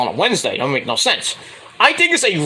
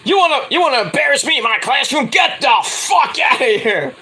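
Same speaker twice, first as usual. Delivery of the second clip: higher shouted rant, rushed then slowing, breaking into a laugh; higher shouting teacher turning to laughter; mock furious shout; 8 semitones higher than usual. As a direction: chase the student out while cracking up. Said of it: "He's like".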